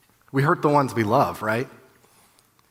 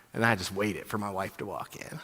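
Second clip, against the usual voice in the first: ordinary talk, nothing marked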